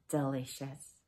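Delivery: ordinary talk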